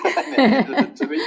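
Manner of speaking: a laugh bubbling through the words. Real, not acted. Recalling his brother handing him a bourbon, laughing. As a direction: chuckle while telling it.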